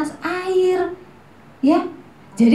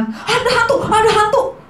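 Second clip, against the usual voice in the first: panicked yelling; 7 semitones higher than usual. Pretend. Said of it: "Semua berteriak-teriak".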